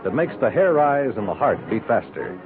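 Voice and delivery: ordinary talk, nothing marked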